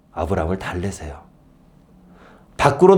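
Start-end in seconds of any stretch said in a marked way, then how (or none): none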